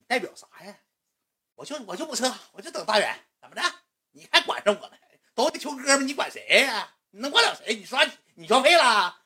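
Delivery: very high-pitched grumbling heckler; defiant heckling. Retold or imitated. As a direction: imitate fans sniping back at the organizer. Defiant fans answering organizer, acted.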